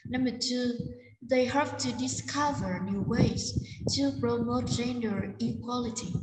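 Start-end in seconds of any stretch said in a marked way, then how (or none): none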